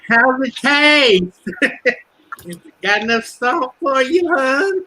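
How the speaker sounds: high, slow, syrupy taunt with a laugh; mocking sweet waitress. Teasing [name] taunting cops from the window, high syrupy mock. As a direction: coo it sweetly, laughing at them.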